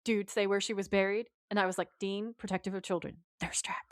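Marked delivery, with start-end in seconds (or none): 2.0-3.9 whispered aside. Retold or imitated. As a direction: breathe it out as a secret joke. Mock caption read as aside.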